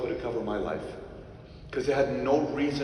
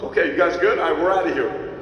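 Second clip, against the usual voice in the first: loud exit; 5 semitones higher, 8 dB louder than usual. Retold or imitated. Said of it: "I'm like".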